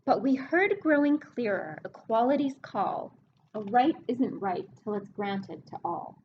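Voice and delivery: storybook reading voice; reading a rhyming book to kids. Acted. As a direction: read the verse to the class, leaning on the rhyme. Teacher reads rhyming book aloud.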